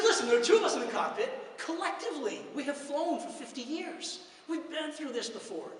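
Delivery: steady the cabin with calm authority; confident pilot. Retold or imitated. Pilot reassuring passengers over the intercom, lightly voiced confident. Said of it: "a very confident pilot".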